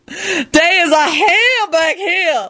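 high, drawn-out panicked wail. A narrator's reading. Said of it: "Lucretia wailed unrepentantly".

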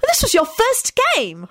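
very high, drawn-out happy cry; high-pitched delighted cheer; happy call. A narrator's reading. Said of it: "Hermione called out happily".

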